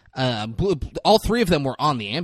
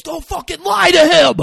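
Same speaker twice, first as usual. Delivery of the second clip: loud, high mock scolding; sudden angry outburst; 10 dB louder than usual. Someone scolds her for lying to the kid, sudden yell. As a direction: blow up instantly, much louder and higher. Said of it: "it elevates it immediately".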